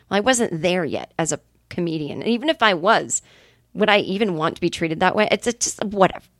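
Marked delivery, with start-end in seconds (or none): none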